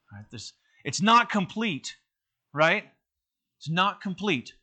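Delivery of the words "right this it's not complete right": ordinary talk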